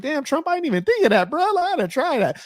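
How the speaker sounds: amazed reaction. Retold or imitated. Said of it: "I was like".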